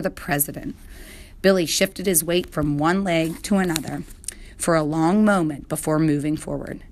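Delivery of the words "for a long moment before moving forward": ordinary delivery, nothing marked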